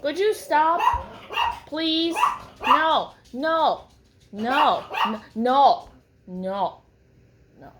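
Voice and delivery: high, loud, desperate pleading; frantic no no no, fading out; panicked pleading. Retold or imitated. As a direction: beg it to stop, rising panic. Panicked plea inside own head.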